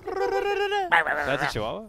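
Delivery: mock squeaky voice.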